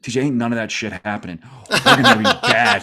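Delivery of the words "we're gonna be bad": much higher and louder, slow, laughing through it; laughing gloom. Real, not acted. Fan laughs telling his cohost the team will be bad, high and loud. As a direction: admit defeat while cracking up.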